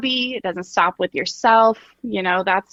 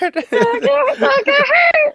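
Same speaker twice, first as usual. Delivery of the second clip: high, laughing warning; laughing mock warning; 4 dB louder, slower than usual.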